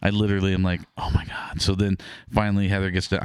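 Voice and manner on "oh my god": whispered, choked-up oh my God; moved whisper. Retold or imitated. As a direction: breathe it out, moved to tears. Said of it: "I'm tearing up because I have kids".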